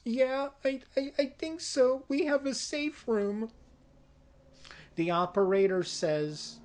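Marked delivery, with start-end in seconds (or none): 0.1-3.5 put-on high, sweet, innocent little girl. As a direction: play the helpless innocent child, higher. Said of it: "very sweet, innocent".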